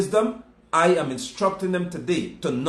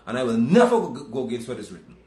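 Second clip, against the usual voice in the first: ordinary talk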